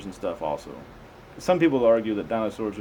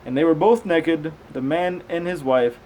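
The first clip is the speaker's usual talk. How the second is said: raised, slow Bible reading voice; slow, measured scripture reading; 5 semitones higher, 4 dB louder, slower than usual. Acted. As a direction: read it out like a careful lesson.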